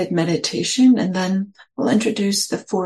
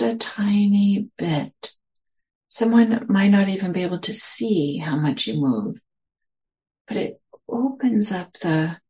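guided meditation. Acted. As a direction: ease listeners into their posture, unhurried.

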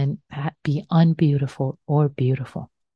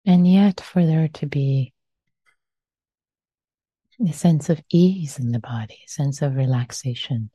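guided meditation. Acted. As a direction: guide them gently into stillness.

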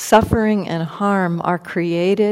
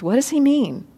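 puzzled; 8 semitones higher than usual. Retold or imitated. Puzzled bandit wondering.

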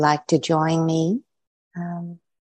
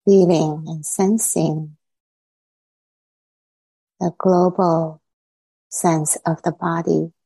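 guided meditation. Acted. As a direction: ease listeners inward, unhurried.

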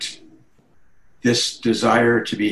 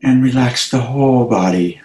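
unhurried meditation guidance with long pauses; guided meditation. Acted. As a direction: guide listeners gently into relaxation, phrase by phrase.

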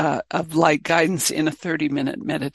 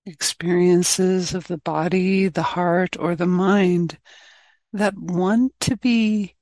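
guided meditation. Real, not acted. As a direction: guide the group gently inward, leaving space between phrases.

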